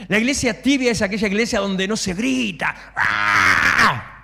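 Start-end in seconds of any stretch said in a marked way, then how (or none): 3.0-4.2 a sudden loud cry of "ah"; short shout. Shown as a demonstration. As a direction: burst out a single shouted "ah". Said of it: "No grito porque tengo muy mala garganta". (grito breve y fuerte)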